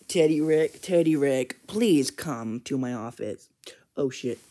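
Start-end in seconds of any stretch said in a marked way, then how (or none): none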